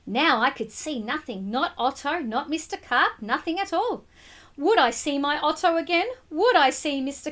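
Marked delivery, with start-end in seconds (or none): none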